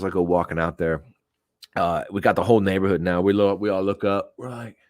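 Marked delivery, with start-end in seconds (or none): none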